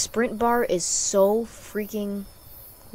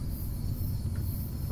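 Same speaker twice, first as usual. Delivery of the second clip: voiceless gasp of fright; sudden sharp intake of breath; startled gasp; 4 dB quieter than usual. Real, not acted. Player scared by game, startled gasp.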